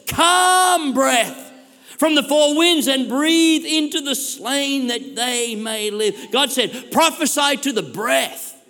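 high, loud prophetic proclamation; full-force preaching. Real, not acted. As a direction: preach the vision with urgent, breathless wonder.

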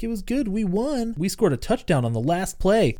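a hint of a boy reporting to mom; imitating kid. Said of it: "And he's like".

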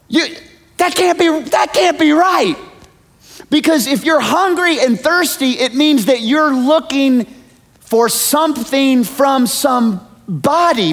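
very high, loud, outraged disbelief; incredulous listener. Acted. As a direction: play the baffled churchgoer at full pitch.

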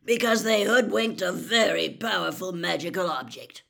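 loud, growled explanation; Moody. A narrator's reading. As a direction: explain it forcefully. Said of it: "said Moody".

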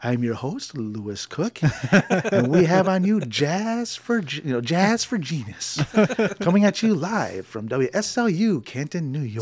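dad on air, imitated as a mellow radio host; imitating a radio DJ voice. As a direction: lay it on like a late-night jazz host. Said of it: "first introduction to radio voice".

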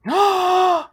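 high, loud gasp; excited gasp. Pretend. Co-host hearing Mister Bean stars, amazed gasp. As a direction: suck in air in mock amazement.

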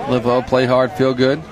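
ordinary talk

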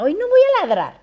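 high, fast mock whine of a lazy dog; perro desganado imitado. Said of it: "Ay, no". (el perro que no quiere ladrar, en queja aguda y rápida)

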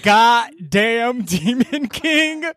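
high, very slow, every word dragged out in disbelief; stunned outrage. Real, not acted. Podcast cohost recapping the twist, outraged and slow. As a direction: spell out the betrayal slowly.

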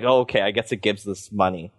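mock begrudging. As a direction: imitate locals reluctantly giving in. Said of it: "begrudgingly being like".

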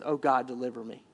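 low, soft acted plea; understated plea for rescue, low and hushed; crying out to God. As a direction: pray it quietly, voice dropped right down. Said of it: "he just cries out and says".

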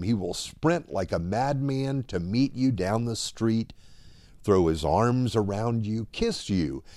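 ordinary talk

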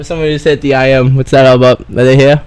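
ordinary delivery

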